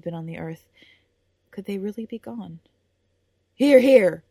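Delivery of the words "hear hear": loud, high booming call from the crowd; booming cheer. Acted. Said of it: "called Gowan's booming voice".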